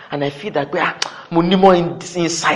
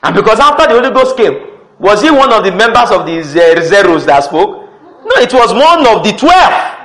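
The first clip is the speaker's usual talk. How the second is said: loud, raised voice driving the point; emphatic preaching; 5 semitones higher, 7 dB louder than usual. Real, not acted. Preacher raising voice in emphatic argument.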